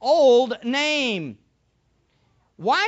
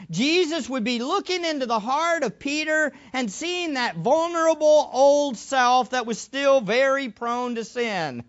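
normal talking voice

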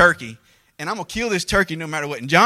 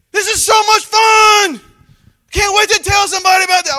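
mock gleeful shout; 10 dB louder than usual. Preacher mocking what he never said mid-hunt, giddy shout. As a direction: ham up the thrill like a giddy kid. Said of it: "I wasn't saying".

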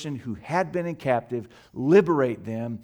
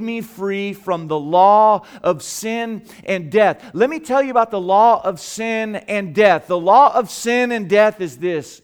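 loud, raised preacher's voice, key words stretched; forceful preaching; 6 semitones higher, 9 dB louder than usual. Real, not acted. Preacher declaring the law of sin and death to the congregation, forceful. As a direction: preach it out with full conviction.

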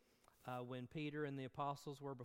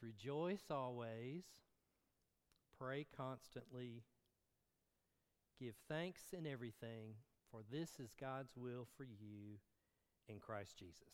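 solemn scripture reading; 2 semitones lower than usual. Acted.

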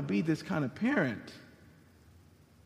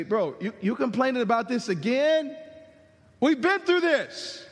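higher, louder, exasperated bro scolding; impatient buddy; 5 semitones higher, 3 dB louder than usual. Pretend. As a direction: brush off a complaining friend. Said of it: "God didn't say".

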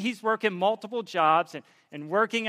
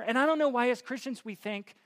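ordinary talk

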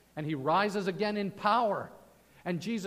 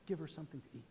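gentle; 4 semitones lower, 12 dB quieter, much faster than usual. Retold or imitated. Same quote repeated softly.

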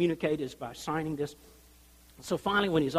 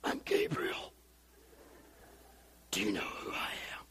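low gravelly whisper, much softer; Clint Eastwood impression; 10 semitones lower, 10 dB quieter than usual. Shown as a demonstration. Preacher voicing Gabriel as Clint Eastwood, whispered. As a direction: whisper it like a tough cowboy. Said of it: "he said it like Clint Eastwood".

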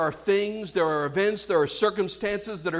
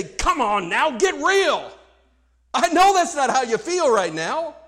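higher, louder acted exasperation; exasperated urging; 6 semitones higher, 5 dB louder than usual. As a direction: plead as if shaking them into honesty. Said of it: "I just want to grab 'em and shake 'em".

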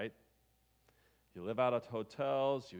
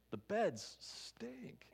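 high slow whisper; whispered complaint; 10 semitones higher, 3 dB quieter, much slower than usual. Acted. Preacher confiding to the congregation about the beds, whispered.

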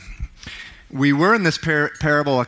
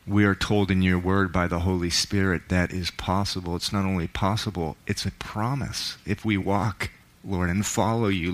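soft, lower prayer voice; 5 semitones lower, 4 dB quieter than usual. Acted. Pastor leading the congregation, soft prayer. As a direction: pray quietly and sincerely.

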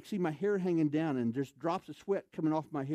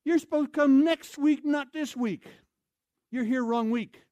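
much higher, much louder foreign shout; yelling in broken English; 9 semitones higher, 7 dB louder than usual. Retold or imitated. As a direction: yell it like a frantic camp manager. Camp manager voiced, broken English.

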